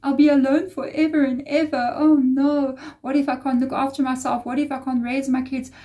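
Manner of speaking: a hammed-up anxious spiral of oh-nos, high-pitched; mock panicked worrying. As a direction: fret in a high voice like someone falling apart. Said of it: "I didn't go emotionally".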